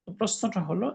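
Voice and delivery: usual speaking voice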